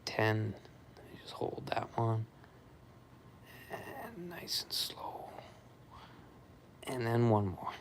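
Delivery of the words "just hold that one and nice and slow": slow soft whisper, drifting high. Real, not acted. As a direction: breathe the words out gently. Coaching a slow exercise hold.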